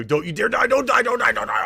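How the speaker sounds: high, loud desperate yelling; desperate shouting. Real, not acted. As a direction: scream at him not to die. Desperate plea in fight.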